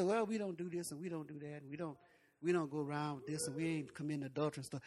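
low, hushed mock piety; self-righteous churchgoers. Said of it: "You know, we so holy".

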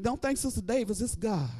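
usual speaking voice

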